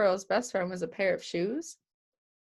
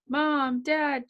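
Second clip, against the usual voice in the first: higher, louder call for Mom and Dad; a kid shouting for her parents after the plant dies; 6 semitones higher, 3 dB louder than usual. A narrator's reading. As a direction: yell for the parents across the house. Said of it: "Judy yelled".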